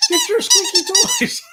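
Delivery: squeaky high dog-toy voice, laughing; mock ad voice.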